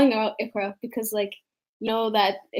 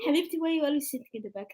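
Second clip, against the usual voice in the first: put-on quick, softer Arabic-accented teacher; mock Arabic teacher, higher and hurried; 3 semitones higher, 8 dB quieter, much faster than usual. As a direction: rattle it off in an imitated Arabic accent. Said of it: "No, she's Arabic".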